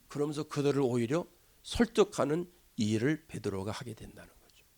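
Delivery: low, very soft, with long pauses; hushed restraint. Real, not acted. Preacher describing Peter patiently enduring criticism, hushed and restrained. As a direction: lay out each word quietly and patiently.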